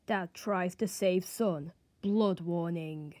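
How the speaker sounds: low, slow and quiet, like a movie trailer; ominous trailer voice. Retold or imitated. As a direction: stretch it out darkly and softly. Reads clickbait video title.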